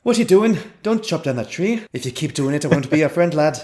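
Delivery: playful Irish telling-off; Irish accent. Shown as a demonstration. Guard telling someone not to chop trees, Irish accent. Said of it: "give it an actual Irish".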